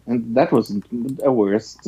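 ordinary talk, nothing marked

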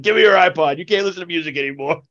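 a bandmate confiscating the iPod, bossy; mock bossy bandmate. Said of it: "He's like".

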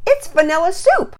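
high exclaimed punchline; excited joke. A narrator's reading. Mom joking about the melted ice cream, playfully exclaimed. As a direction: pitch the punchline way up.

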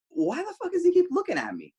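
retold high, incredulous outburst; exasperated disbelief. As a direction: pitch way up, acting out your bewilderment. Retells exasperation at being stared at.

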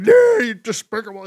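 a pompous official cries out in outrage; mock outraged shout. Shivani scolding the heels, loud mock outrage. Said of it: "hear Shivani be like".

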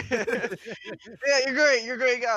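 high, loud, hammed-up enthusiasm; effusive praise. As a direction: gush over a new buddy, pitch way up. Acted enthusiastic praise after moonshine offer.

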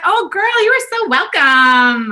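put-on very high, loud, drawn-out Valley Girl; hammed-up American gush, stretching the words; gushing American server. Delighted lunch server replying to a customer, mock gushing Valley Girl. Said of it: "she was like".

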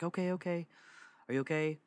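high, lightly voiced worried grandmother; mildly worried grandma. Grandma to grandson leaving in the night, mildly worried. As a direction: imitate a woken grandma's mild concern. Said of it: "kinda worried about me".

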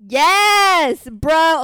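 loud, high confirmation; big, high-pitched outburst; exasperated shout. Real, not acted. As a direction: yell it in exasperated disbelief. Exclaiming that it really happened.

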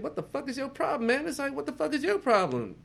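high, loud, indignant; mock outraged shout. Retelling his outburst at his friend over the shrooms. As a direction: bark it like an outraged buddy.

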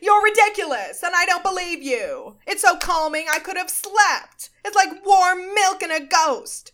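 high, loud, hammed-up comic rant; mock outrage pushed way up in pitch; Billy Eichner. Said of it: "That was my Billy Eichner".

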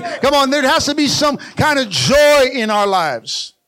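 preaching at full force to stir up joy; rousing preaching. Acted. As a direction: fire up the congregation. Pastor rousing the congregation.

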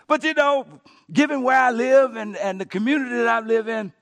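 loud, high excuse-making; mock complaint. Homeowner blaming the community they live in, loud hammed-up complaint.

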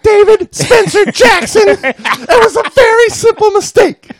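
high, loud falsetto full-name scold; scolding wife voice. Acted. Wife using her husband's full name, high falsetto scold. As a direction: scold him like an exasperated wife.

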